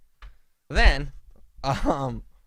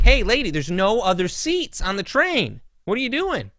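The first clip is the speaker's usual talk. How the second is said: confronting a passenger; 6 semitones higher, 3 dB louder than usual. Retold or imitated. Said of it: "I just interrupt him".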